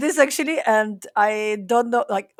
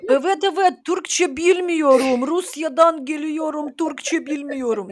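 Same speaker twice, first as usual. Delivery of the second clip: Turkish with a put-on Russian accent, slow and higher; slow, careful Turkish in a Russian accent; 4 semitones higher than usual. Shown as a demonstration. Joining the game with Turkish in a Russian accent. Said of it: "can you do Russian accent in Spanish?".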